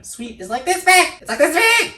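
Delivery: very high, playful squeak; tiny squeaky voice. Pretend. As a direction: go way up high, as tiny as the thing you describe. Mimics the tiny smallest size.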